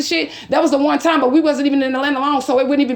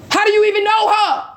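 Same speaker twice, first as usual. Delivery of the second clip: much higher, stunned disbelief; incredulous; 8 semitones higher than usual. Retold or imitated. To the viewers about the ex's new wife, pitched up in disbelief. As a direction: repeat it like you can't believe it. Repeats question, higher the second time.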